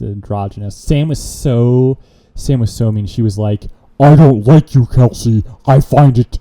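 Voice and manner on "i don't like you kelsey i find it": louder, whiny complaining coworker voice; a coworker griping in a put-on voice; wrong put-on voice. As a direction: put on a different voice to gripe about a coworker. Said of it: "I was so focused on the voice".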